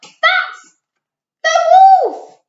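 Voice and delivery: high loud warning cry; shouting. A narrator's reading. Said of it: "Gertie shouted".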